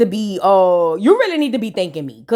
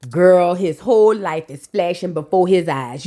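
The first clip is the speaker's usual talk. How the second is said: ordinary talk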